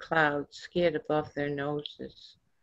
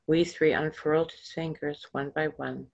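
ordinary delivery, nothing marked

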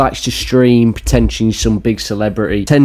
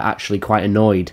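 ordinary delivery

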